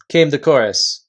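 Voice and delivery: ordinary delivery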